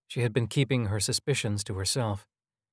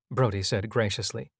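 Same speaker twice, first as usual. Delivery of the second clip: usual speaking voice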